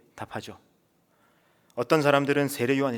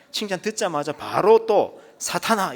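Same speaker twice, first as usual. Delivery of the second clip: emphatic exclamation; 7 semitones higher, 7 dB louder, slower than usual. Real, not acted. Preacher stresses the reversal.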